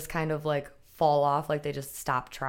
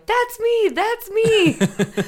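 high, loud reenacted shout; excited retold yell; 9 dB louder, much slower than usual. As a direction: yell it out as if spotting yourself on the big screen. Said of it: "was yelling".

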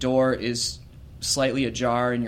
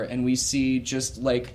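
usual speaking voice

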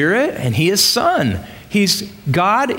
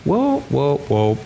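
inner alarm; 3 semitones lower than usual. Retold or imitated. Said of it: "something inside of you that says".

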